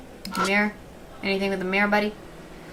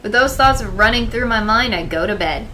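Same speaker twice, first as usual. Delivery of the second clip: louder, steady storytelling read; story narration; 3 semitones higher, 8 dB louder than usual. Acted. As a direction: read it like a novel. Reading game narration aloud.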